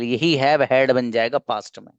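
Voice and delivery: ordinary talk, nothing marked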